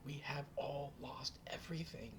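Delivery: high, breathless weeping whisper; whispered sob. Acted. Old mother ending her lament, whispered high. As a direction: let it fall to a broken whisper. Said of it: "She just sobs".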